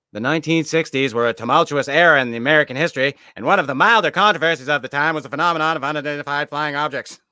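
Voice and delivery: put-on fast, loud, high old newsreel announcer; 1940s newsreel voice. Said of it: "Why are you using a nineteen forties voice".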